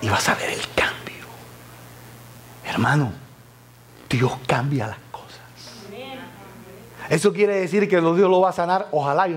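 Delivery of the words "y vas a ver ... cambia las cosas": susurrado, muy bajo; confíaselo a la congregación en secreto; whisper. Acted. Susurro confidencial al público. (susurro íntimo, casi sin voz)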